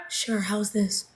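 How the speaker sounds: barely audible, very soft; mock whisper. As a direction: pretend to speak up while staying tiny. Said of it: "Can you speak louder?".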